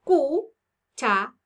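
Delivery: "Cuchara" loud and slow: cu, cha, ra; "Cuchara" counted out in three separate beats; syllable by syllable. Shown as a demonstration. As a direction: pause between the syllables of "Cuchara". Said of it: "Vamos a contar cuántas sílabas".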